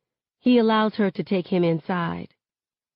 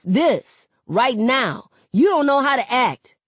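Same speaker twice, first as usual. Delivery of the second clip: higher and louder, accusing; angry shouting; 7 semitones higher, 5 dB louder than usual. Acted. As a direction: hurl it at her. Seven shouting at mother.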